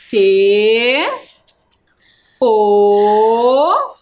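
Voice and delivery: "T. O." loud, long held letters, far apart; slow spelling. Shown as a demonstration. Teacher writing tor for pupils, "T. O." spelled slowly. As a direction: hold each letter of "T. O." as if writing it.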